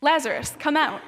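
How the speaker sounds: loud voice, short command; loud call. A narrator's reading. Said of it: "called in a loud voice".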